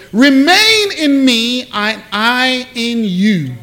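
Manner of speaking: slow, solemn scripture quote; scripture reading. Acted. Preacher reading Jesus's words to the church, solemn. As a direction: read Jesus's words slowly, with weight.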